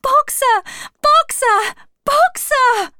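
very high, loud repeated calls; desperate shout. A narrator's reading. Clover running behind the lorry, frantic shouting. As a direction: cry the name frantically. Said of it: "she shouted".

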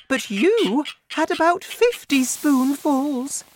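very high, loud mother voice; high-pitched, alarmed scolding; horrified cry. A narrator's reading. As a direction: panic at what she has done. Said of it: "Missus Cranky cried".

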